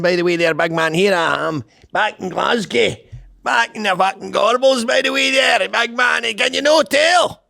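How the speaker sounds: high, hammed-up Scottish accent; put-on Scottish accent. Host hanging with fellow Scots in Glasgow, hammed-up Scottish accent. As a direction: play a shouty, high-pitched Scot among mates. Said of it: "doing a Scottish accent".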